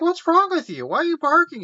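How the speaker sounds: very high-pitched, put-on girl's voice; imitated puzzled questioning. Girl asking why the dog is barking, high imitated alarm.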